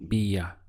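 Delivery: "bia" sounded out as the word's second part; "bia" as the falling end of "lluvia"; syllable shown alone. Shown as a demonstration. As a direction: give "bia" separately, with a b sound. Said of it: "followed by".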